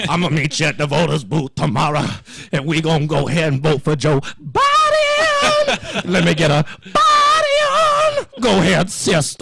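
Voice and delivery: mocking a politician's church voice; mock preacher voice.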